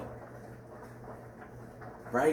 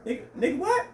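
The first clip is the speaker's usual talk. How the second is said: disbelief. Real, not acted. Outraged reaction to husband's betrayal.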